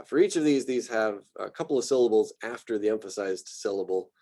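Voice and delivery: ordinary talk, nothing marked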